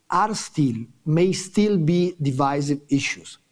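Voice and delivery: ordinary talk, nothing marked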